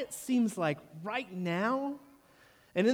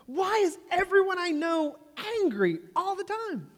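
exasperated question; 10 semitones higher than usual. Retold or imitated. Exasperated question about angry friends.

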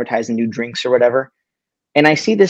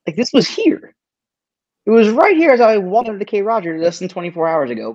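much higher, incredulous and baffled; disbelief; 9 semitones higher than usual. Retold or imitated. Retelling the vanished soda display, pitched-up disbelief. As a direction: squeak it out like you can't believe it's gone. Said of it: "And I'm like".

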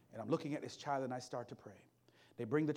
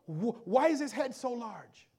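the father's confused question, a bit higher and louder and slow; 8 semitones higher, 6 dB louder, slower than usual. Retold or imitated. Bewildered father asking the staff, slow and pitched higher. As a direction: ask it like the bewildered dad seeing his son's head. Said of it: "and he goes".

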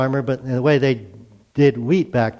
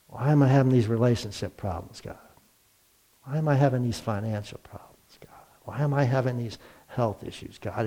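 whispered questions to God; whispered plea; 2 semitones lower, 7 dB quieter than usual. Retold or imitated. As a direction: whisper each complaint to God.

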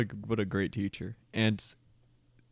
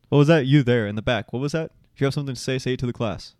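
calling out a student at the back, louder; mock professor; 3 semitones higher, 4 dB louder, faster than usual. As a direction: put the heckler on the spot like a teacher. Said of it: "the professor comes and whispers".

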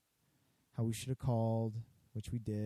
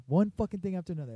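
higher, louder, rushed grumble; faster mock angry rant; 7 semitones higher, 7 dB louder, faster than usual. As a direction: repeat it quicker while walking off. Angry customer storming off.

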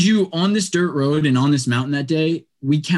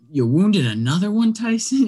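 higher-pitched mock disbelief, rising sharply on the name; retold incredulous question, pitched up at the end; imitated incredulous tracker; 8 semitones higher than usual. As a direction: voice her dismay at yet another call. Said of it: "you can hear it in her voice".

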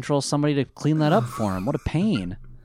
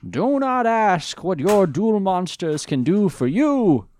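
put-on slow, higher Boston accent of JFK; JFK impression; 7 semitones higher, 4 dB louder, slower than usual. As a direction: orate in a Boston JFK accent like a grand speech. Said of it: "like, J F K or something".

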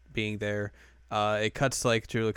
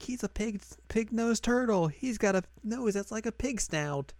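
high girlish cartoon voice; Yui explaining; 12 semitones higher than usual. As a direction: imitate her explanation up high. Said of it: "Yui talks about Tonchan".

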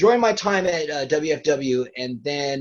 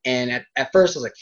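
ordinary delivery

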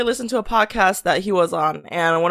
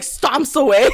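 ordinary talk

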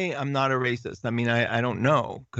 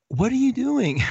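kindly woman asking; 11 semitones higher, 3 dB louder than usual. Retold or imitated. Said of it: "And she said".

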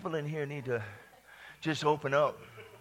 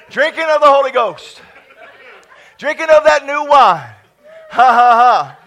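loud, laughing proclamation; loud joyful preaching; 3 semitones higher, 11 dB louder than usual. Real, not acted. Preacher whipping up the church, loud and laughing. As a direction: shout it out through laughter.

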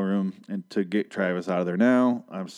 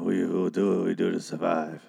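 gruff speech impression; 3 semitones lower than usual. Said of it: "he doesn't sound like that".

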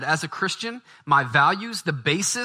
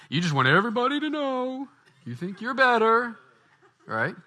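high, mocking critic voice; mocking sneer; 9 semitones higher than usual. Preacher mocking critics who judge Bible carriers, high sneer.

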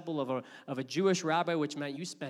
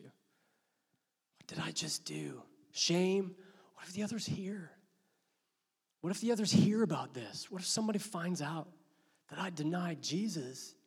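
hushed inner guilt. Acted. Peter's inner guilt monologue.

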